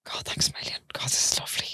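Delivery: whispered thanks. Retold or imitated. Whispered thanks on plane steps.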